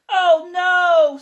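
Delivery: high, loud wail of despair; Mister Bear's loud, high, exasperated wail; exasperated cry. Acted. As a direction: cry out like a fed-up bear.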